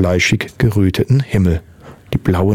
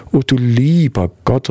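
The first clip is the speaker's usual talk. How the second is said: distressed exclamation; 4 semitones higher than usual. A narrator's reading. Husband at his screaming wife's bedside, mild distressed cry. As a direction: call out in helpless dismay, restrained. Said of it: "rief Ed Thatcher und rang die Hände".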